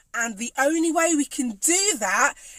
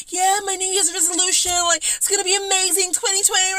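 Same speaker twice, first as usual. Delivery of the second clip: higher, gushing mock-excited voice; mock hyped resolution; 7 semitones higher, faster than usual. Mocking people who set big New Year goals, hammed-up excited. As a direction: send up the starry-eyed goal-setter.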